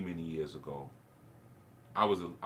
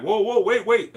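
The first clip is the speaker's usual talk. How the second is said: high, loud imitation of a startled cop; alarmed shout; 13 semitones higher, 15 dB louder than usual. Startled cop to a boy with a stick, alarmed shout. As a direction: shout it like fending off an attacker.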